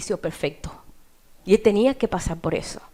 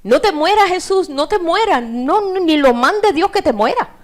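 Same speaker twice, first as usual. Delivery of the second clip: raised, higher-pitched pleading; pleading protest; 6 semitones higher, 4 dB louder than usual. Acted. Peter objecting to Jesus dying, pleading protest. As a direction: protest urgently as a worried disciple.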